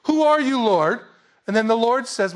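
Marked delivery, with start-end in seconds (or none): 0.0-1.0 high, loud cry of alarm; panicked Paul. Acted. Preacher acting Paul facing the Lord, loud and panicked. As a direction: shout the question as if you are done for. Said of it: "he knew he was done for".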